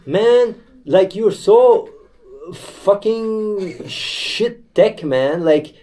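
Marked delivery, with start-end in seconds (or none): none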